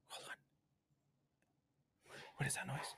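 scared whisper. Real, not acted. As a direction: whisper at a strange noise. Home alone hears noise.

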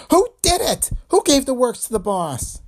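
high, loud furious demand; fierce demand. Acted. Dame fiercely demanding who killed the boss.

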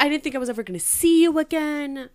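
lovestruck hero. Retold or imitated. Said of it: "And he was like".

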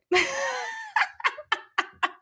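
high squealing laugh; high burst of laughter. Real, not acted. Laughing at her own slip about the peach.

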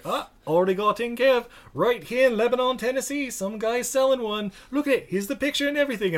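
high-pitched, eager imitation of a woman with it all arranged; Zoe Bell. Zoe telling staff she found the car herself, imitated high and eager. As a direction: pitch it well up, imitating her keen reply. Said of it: "And she's like".

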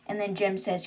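ordinary delivery

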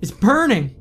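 high, loud, panicked pleas; desperate begging. A narrator's reading. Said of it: "began begging".

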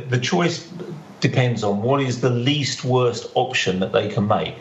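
ordinary delivery

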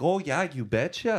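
put-on folksy Upper Midwest Minnesota accent; lightly hammed Minnesota accent. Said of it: "just a Fargo accent".

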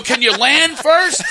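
high, loud, slow pleading; exasperated protest. Acted. Uncle to airborne toddler, loud exasperated protest. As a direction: plead loudly with an impatient kid.

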